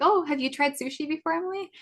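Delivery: retold question lifting high on the name; wife's friendly question. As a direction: act out her casual asking. Wife asks a casual question.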